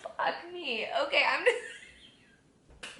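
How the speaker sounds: ordinary delivery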